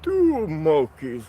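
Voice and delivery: slow, high Transylvanian count voice; Count impersonation. Pretend. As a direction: count out slowly like a vampire count. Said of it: "count impersonation".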